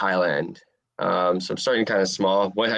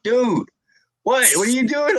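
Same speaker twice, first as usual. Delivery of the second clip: much higher, louder mock disbelief; incredulous coach; 4 dB louder than usual. Coach reacting to Italian beef before fight, high incredulous mock voice. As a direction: squeak out the coach's shock at the news.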